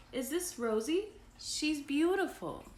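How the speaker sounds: high-pitched storybook admiration; admiring. A narrator's reading. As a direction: gush over a visitor's pet. Mom admires the parrot, read aloud.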